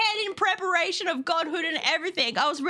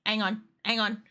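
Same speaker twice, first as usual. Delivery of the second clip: ordinary talk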